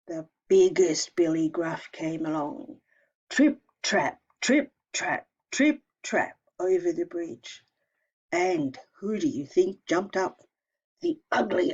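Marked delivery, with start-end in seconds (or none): 3.3-6.4 heavy, louder stomping beat; stomping trip trap. A narrator's reading. Teacher stomping the biggest goat's hoofsteps, loud. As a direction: stamp each step like a big goat. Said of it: "biggest goat stomps".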